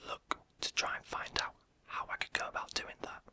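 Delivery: ordinary delivery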